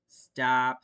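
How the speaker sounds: loud sharp call; mother calls her son back; yell. A narrator's reading. A mother yells loudly. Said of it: "Stella yelled".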